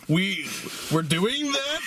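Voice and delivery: halting, high-pitched disbelief; stumble, then pitch the question way up; acted incredulity. Acts out own stunned reaction.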